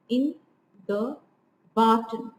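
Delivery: word by word, leaning on "barton" after two light beats; anapest stress. Shown as a demonstration. Lecturer sounding out an anapest for students, anapest stress. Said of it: "there are two unstressed here".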